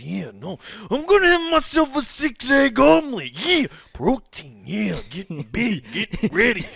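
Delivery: high raspy wrestler rant; Macho Man voice. Acted. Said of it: "the Macho Man as good as you did".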